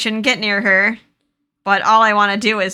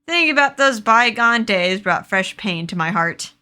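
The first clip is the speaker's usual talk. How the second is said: usual speaking voice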